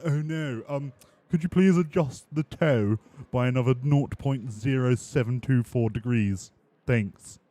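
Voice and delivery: polite Oscar voice. Retold or imitated.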